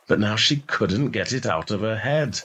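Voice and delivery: usual speaking voice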